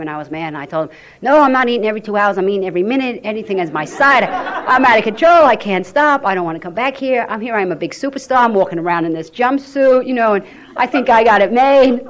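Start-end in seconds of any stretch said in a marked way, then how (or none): 1.2-12.1 higher, louder outburst with bursts of laughter; retold screaming. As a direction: let it all pour out at him, out of control. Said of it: "I was really screaming at him".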